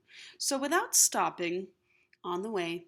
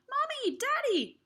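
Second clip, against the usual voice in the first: put-on excited children shouting. Children call their parents, high and thrilled. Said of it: "they began shouting".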